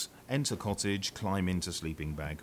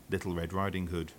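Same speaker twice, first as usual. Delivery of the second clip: ordinary delivery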